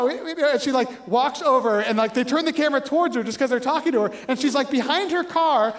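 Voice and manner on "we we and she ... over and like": usual speaking voice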